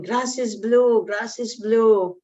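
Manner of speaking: drawn-out, stubborn claim as the donkey; arguing donkey. Acted. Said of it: "the donkey is saying".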